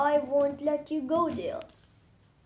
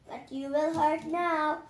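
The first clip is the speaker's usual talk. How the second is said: ordinary talk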